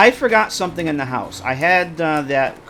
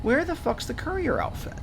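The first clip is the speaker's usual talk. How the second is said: exasperated; 10 semitones higher, 6 dB quieter than usual. Real, not acted. Frantic search for outfit.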